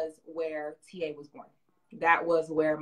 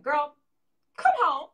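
high, loud motherly call with a laugh; mom telling her to come home; 8 semitones higher, 8 dB louder, slower than usual. Retold or imitated. Imitating her mom urging her home, loud and high. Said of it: "she'd be like".

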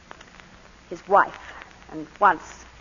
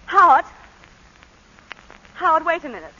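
high, loud urgent call; calling him back; 6 semitones higher, 6 dB louder than usual. Acted. Wife calls the departing lawyer back, loud and urgent. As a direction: stop him before he reaches the door.

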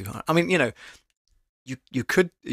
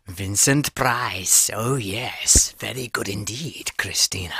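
slow, lower, sinister horror drawl; Vincent Price; 4 semitones lower, slower than usual. Retold or imitated. Vlogger imitating Vincent Price to praise Christina. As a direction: savor each word like a horror host. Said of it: "Christina says, "Vincent Price".

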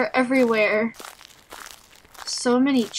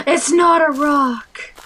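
gasp, then alarmed exclamation; sharp intake, then startled cry; 3 semitones higher, 3 dB louder than usual. Real, not acted. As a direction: gasp and blurt it out in fright. Alarmed discovery in game.

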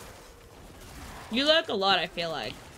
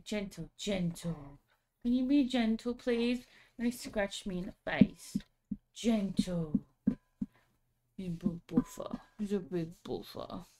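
softer coaxing voice to the dog; soothing; 2 semitones lower, 4 dB quieter than usual. Real, not acted. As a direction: settle the puppy gently.

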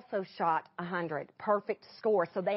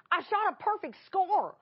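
higher-pitched, elated retelling of big news; excited husband, imitated; retold thrilled announcement; 9 semitones higher than usual. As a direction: proudly announce it, voice lifted.

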